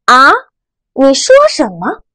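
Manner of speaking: 啊 rising like a puzzled question. Shown as a demonstration. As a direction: ask 啊 with a rise, not catching it. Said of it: "戴上第二顶帽子". (啊，第二声，疑问语气)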